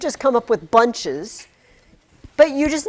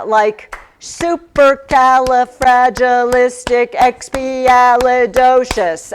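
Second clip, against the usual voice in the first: slow, louder, syllable by syllable; clapped syllables; 7 dB louder, much slower than usual. Shown as a demonstration. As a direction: clap out each syllable. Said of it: "and you clap".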